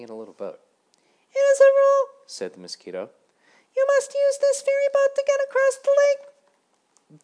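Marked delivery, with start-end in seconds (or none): 1.3-2.1 very high, loud squeaky little mosquito; a tiny self-important ferryman insisting on rules. A narrator's reading. Mosquito ferryman lecturing Grasshopper, very high and loud. 3.8-7.2 very high, loud squeaky little mosquito; a tiny self-important ferryman insisting on rules. A narrator's reading. Mosquito ferryman lecturing Grasshopper, very high and loud.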